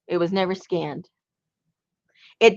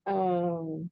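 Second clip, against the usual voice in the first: usual speaking voice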